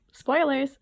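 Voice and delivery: spoilers called out loud and high, then a laugh; loud spoiler alert. Real, not acted. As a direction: blurt out the warning and laugh. Sudden spoiler warning.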